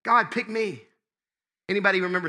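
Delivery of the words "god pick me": higher-pitched plea to be picked; eager volunteering. Acted. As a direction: call out keenly, first in line. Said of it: "I should be first in line going".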